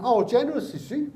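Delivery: high-pitched scornful echo; mock incredulous. Granddad answering the Social Security man, sarcastically incredulous. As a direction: throw the word back, pitched right up. Granddad scoffs at official's remark.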